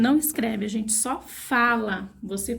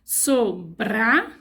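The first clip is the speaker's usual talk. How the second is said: "Sobrado" drawn out slowly, syllable by syllable; slow syllable spelling; 2 semitones lower, much slower than usual. Shown as a demonstration. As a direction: stretch "Sobrado" out for children to write. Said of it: "deixa essa sílaba mais forte".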